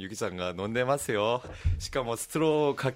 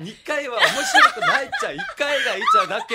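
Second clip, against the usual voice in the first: high laughing protest; 9 dB louder than usual. Real, not acted.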